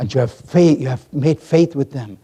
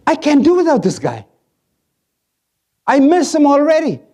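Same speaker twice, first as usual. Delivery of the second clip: high, louder voice of God longing; God missing Moses; 9 semitones higher, 3 dB louder, faster than usual. Retold or imitated. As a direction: lift high as God who can't let go.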